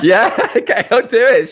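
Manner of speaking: real amused laugh. Real, not acted. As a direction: play along with the joke through a laugh. Joking about his wife calling.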